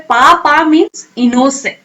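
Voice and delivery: slow, with "पापा" stressed; slow, deliberate teacher voice; slow explanation. Shown as a demonstration. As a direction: explain it slowly and clearly. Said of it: "Kannada में इसके लिए एक word है".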